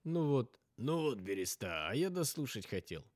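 a touch of a disappointed man; mild complaint in a character voice; hint of a grumbling companion; lightly voiced character.

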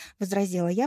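usual speaking voice

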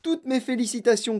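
high, effusive congratulations; crying out with delight; exuberant cry. A narrator's reading. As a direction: gush it out, high-pitched. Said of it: "cria-t-il".

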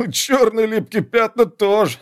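put-on high cackling old shaman's voice; cackling old Nep. A narrator's reading. Old Nep taunts the soldier about his curse, high cackling voice. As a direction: crow it out like a gleeful old hex-caster. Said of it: "Неп кудахнул".

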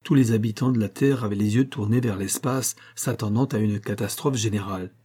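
ordinary delivery, nothing marked

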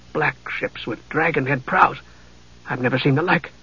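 quiet amazement at the strange ships; awed. A narrator's reading.

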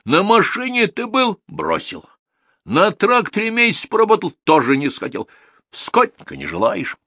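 indignant, high-pitched interrogation; boiling up. A narrator's reading. Manager lists jobs Viktor has quit, boiling up. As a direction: fire off the list of failings, worked up. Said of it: "закипел управляющий".

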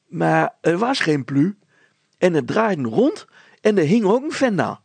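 put-on high, loud, tumbling girl's voice; a breathless girl blurting out what she saw; flustered girl. Petronella describes flying thing.

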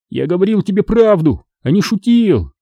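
high, triumphant insistence; joyful yelling. A narrator's reading. Boy vindicated before father, joyful yelling. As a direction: crow that it was true. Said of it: "вопил я от радости".

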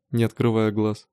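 plain reading voice

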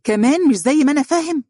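high-pitched mocking echo; incredulous, sarcastic squeal of a question. A narrator's reading. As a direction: parrot it back in disbelief, voice shooting up. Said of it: "وقال ساخرا".

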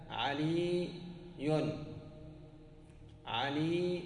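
علي with a long held ī, then "-yun": "ʿalīīī-yun"; drawn-out ya. Shown as a demonstration. Teacher showing the extra ya, علي said "ʿalīīī-yun".

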